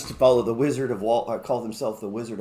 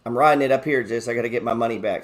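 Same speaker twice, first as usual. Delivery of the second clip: ordinary delivery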